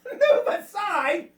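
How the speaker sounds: high, drawn-out outraged echo; indignant cat. A narrator's reading. Cat scoffing at the land crabs, indignant.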